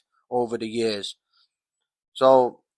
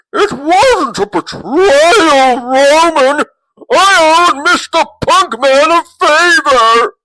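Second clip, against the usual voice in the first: much higher, louder, pleading squeal; a whiny hammed-up plea; Heyman impression; 13 semitones higher, 8 dB louder, slower than usual. Mocking Heyman pleading with Roman, high and loud. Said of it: "then he says to Roman".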